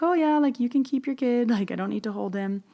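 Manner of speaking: high, breezy no-thanks voice; awkward brush-off. Retold or imitated. Brushing off holding the stranger's baby.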